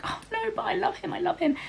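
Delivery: very high, soft pleading voice; hammed-up little wail of devotion; mock lovesick plea. Acting out the mistress's lovesick plea.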